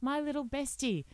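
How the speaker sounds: high, drawn-out mock gushing; gushy mock caption. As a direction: read it like a soppy social media post. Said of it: "and say".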